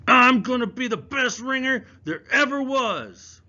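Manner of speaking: loud, high defiant scream; furious boast yelled at full force. Acted. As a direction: scream it at the staring kids. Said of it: "He screamed".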